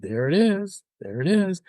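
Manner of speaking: lightly amused, pitch lifted on each take; knowing little sing-out, mildly amused; retold snicker. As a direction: recall it with a hint of a snicker. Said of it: "I kinda snickered and laughed".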